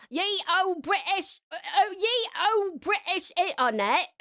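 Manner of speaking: slow, high, hammed-up fake British accent; mock British accent for the chat; garbage British accent. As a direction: drag it out in a silly olde-English British voice. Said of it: "that really garbage accent".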